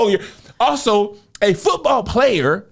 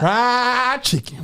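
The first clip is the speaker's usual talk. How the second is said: slow drawled Southern fried chicken; exaggerated Southern drawl on the words; southern twang mimic; 3 semitones higher, much slower than usual. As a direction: stretch it out like a Southern wife. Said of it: "she has the southern twang".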